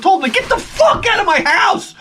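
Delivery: high, fierce imagined yelling; imagined shout. Pretend. Imagining throwing the salesman out, high and shouting. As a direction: yell what you wish you'd said.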